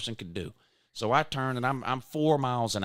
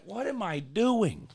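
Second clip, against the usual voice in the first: self-reproach; 10 semitones higher, 5 dB louder, slower than usual. Retold or imitated. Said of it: "and I'm like".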